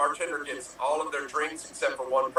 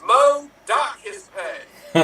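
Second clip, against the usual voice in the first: loud, high, drawn-out punchline; called-out order; 7 semitones higher, 7 dB louder, much slower than usual. Acted. Boss calling to Mo about the lazy helper, called-out order. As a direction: call it across the bar, slowly and higher.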